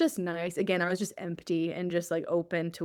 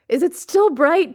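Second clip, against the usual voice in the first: emphatic; 9 semitones higher, 10 dB louder than usual. Retold or imitated. Testing herself, hands over eyes.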